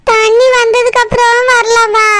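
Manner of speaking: 1 crying plea. Acted. Acted pleading, crying voice.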